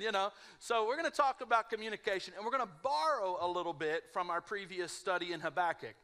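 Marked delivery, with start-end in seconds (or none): none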